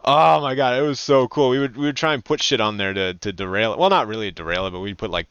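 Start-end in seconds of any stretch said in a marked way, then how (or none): none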